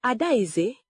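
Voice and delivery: ordinary delivery, nothing marked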